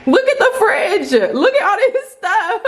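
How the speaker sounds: squealing with laughter; laughing excitedly. Real, not acted. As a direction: laugh through the words, thrilled. Laughing at stocked fridge.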